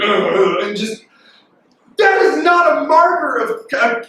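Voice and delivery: normal talking voice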